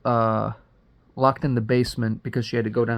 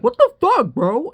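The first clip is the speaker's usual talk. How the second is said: high, loud mock-panicked yelp; startled yell; 7 dB louder than usual. Man woken from nightmare yells back at her, startled. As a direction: yell it back in a shocked squeal.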